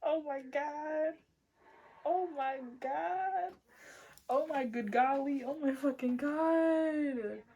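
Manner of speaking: high, drawn-out hammed-up wail; mock wailing. Reader overcome by someone's heartbreak, hammed-up wailing. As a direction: wail it high, stretching each exclamation in mock despair.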